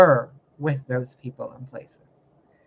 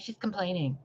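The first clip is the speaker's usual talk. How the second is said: much softer, muttered mock of critics; quiet mocking; 3 semitones higher, 10 dB quieter than usual. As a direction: toss off the critics' words under her breath. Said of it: "And you're like".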